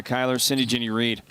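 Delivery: ordinary talk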